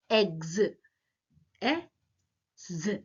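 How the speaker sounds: "Eggs" whole, then "E" and "Z" apart; sound by sound. Shown as a demonstration. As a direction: split "Eggs" into first vowel and final Z. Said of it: "onset, coda, both exist".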